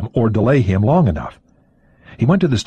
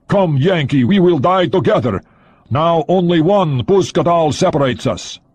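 taunting Soviet agent; 8 semitones higher than usual. A narrator's reading. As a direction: beckon him to die together, pitched high.

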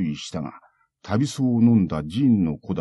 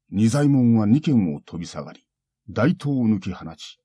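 usual speaking voice

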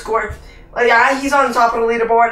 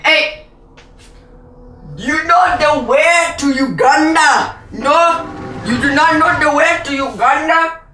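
Uganda Knuckles meme voice; 4 semitones higher than usual. Retold or imitated. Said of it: "If you guys don't know that meme".

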